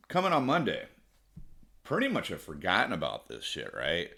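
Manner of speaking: ordinary talk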